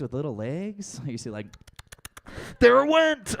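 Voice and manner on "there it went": high, loud shriek of alarm; startled yell. Acted. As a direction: yell it as if a bug just scurried past. Acting out spotting a fleeing bug.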